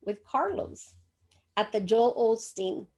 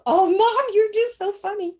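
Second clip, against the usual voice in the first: higher, louder son laughing it off; amused son brushing off his mother, higher and louder; 10 semitones higher, 6 dB louder than usual. Retold or imitated. Son laughing at mom's wedding dress plan, higher and louder. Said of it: "He laughed at me".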